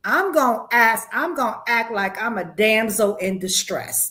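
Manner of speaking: ordinary delivery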